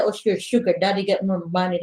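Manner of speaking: ordinary delivery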